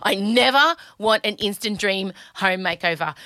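ordinary talk, nothing marked

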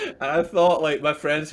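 high, loud voice just out of a laugh; amused, pitch and volume pushed up by laughing. Real, not acted. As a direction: talk on through the tail of real laughter. Still laughing after the punchline.

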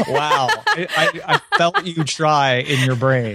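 laughing through the words; real laughter, slowed down. Real, not acted. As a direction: giggle while teasing. Laughing at co-host.

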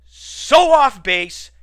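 loud, high, drawn-out protest; indignant outburst. Real, not acted. Podcaster dismissing a critic's review, indignant outburst.

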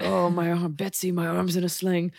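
lightly voiced Civil War soldier. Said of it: "It's very Civil War".

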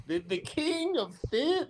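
ordinary talk

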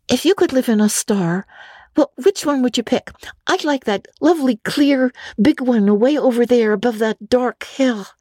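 put-on high, quick young girl voice; a chattering imaginative orphan girl; Anne, girlish and eager. Anne complains to Marilla, high girlish voice. Anne's voice.

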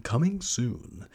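slow, drawn-out movie-trailer tease; teaser. Acted. Host teasing listeners about upcoming projects, slow trailer tease. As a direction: stretch it out like an announcement.

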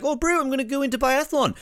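high, hammed-up broad Kiwi accent; mock a broad Kiwi accent, pitched high. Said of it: "make as fun of her accent".

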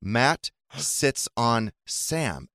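very slow, word by word; beginner-book reading. Retold or imitated. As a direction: read it out slowly like an early reader book. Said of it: "the book that we were reading".

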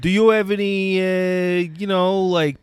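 Brooklyn wise guy asking about perks; Brooklyn accent. Pretend. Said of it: "I always liked the Brooklyn guy".

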